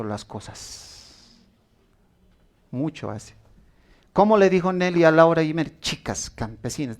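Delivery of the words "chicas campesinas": a mild, sneering put-down said quietly; contemptuous tone. Retold or imitated. Mean schoolgirl mocking the new farm girls, lightly scornful. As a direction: look down on the farm girls a little. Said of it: "de un tono despectivo".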